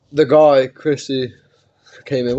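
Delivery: normal talking voice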